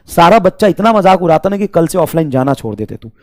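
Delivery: usual speaking voice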